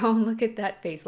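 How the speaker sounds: loud, delighted burst at the screen; gleeful loud reaction; excited outburst. Real, not acted. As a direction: point at the face and laugh it out. Reacting loudly to character's face.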